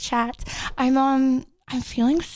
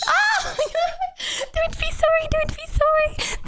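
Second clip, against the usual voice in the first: high squealing giggle, much higher; excited squeal. Real, not acted. Streamer reassuring an apologetic chatter, delighted high squeal.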